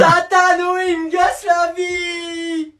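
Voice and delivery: high, slow shouted complaint to auntie; whiny shout. Acted. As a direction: yell for auntie like a tattling kid. Appelle sa tante en criant. (crie vers tata en pleurnichant)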